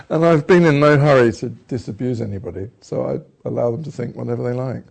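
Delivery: ordinary talk, nothing marked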